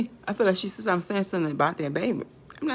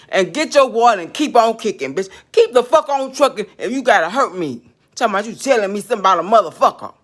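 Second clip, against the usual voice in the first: loud real anger throughout; rage, spitting the words; furious rant; 8 dB louder than usual. Real, not acted. Telling off the woman at the well, loud furious rant.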